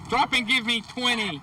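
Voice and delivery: high barked drill sergeant order; barking gym coach. Acted. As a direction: bark it like a boot camp sergeant. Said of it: "and even a drill sergeant".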